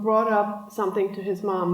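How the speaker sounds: normal talking voice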